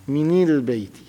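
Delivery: مني للبيت with both nasal sounds given kasra, said heavily; heavy kasra demo. Shown as a demonstration. Teacher shows students the awkward kasra, heavily sounded. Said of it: "في ثقل أو لا في النطق؟".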